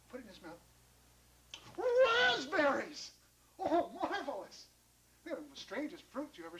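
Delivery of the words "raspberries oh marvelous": high, loud, long-drawn exclamation; ecstatic delight. Acted. John's first taste of the fruit, ecstatic. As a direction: stretch it out in amazed rapture.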